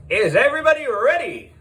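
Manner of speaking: high, loud, fast hype; hyping the crowd. Acted. Host hyping everyone before the start, high and fast. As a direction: pump the crowd up.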